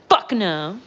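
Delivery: a loud, blunt refusal voiced for the dog; dog's loud retort. The dog Butter refusing to leave the room, loud and blunt. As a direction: bark the refusal back like a cheeky dog. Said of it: "And Butter's like".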